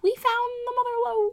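leprechaun. Shown as a demonstration. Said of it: "You sound like a leprechaun".

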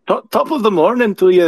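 Irish accent. Shown as a demonstration. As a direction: put on a mock Irish lilt. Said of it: "I was good at an Irish accent".

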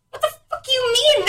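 high, exasperated what-the-fuck; retelling the moment of disbelief at her toddler; outraged mom. Retold or imitated. Mom's outraged retold reaction.

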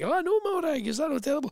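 high, soft put-on chatter; imitated gossiping woman. Mum gossiping with Morag mid-shop, imitated high gossip voice. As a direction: chat like a scandalised gossip overheard. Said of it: "Then you hear, like".

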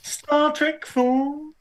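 high-pitched mock Brummy; Brummy accent. Co-host joining in on the Star Trek joke, Brummy accent. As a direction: stretch it out in an exaggerated high Brummy voice. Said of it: "gonna do a Star Trek Brummy accent".

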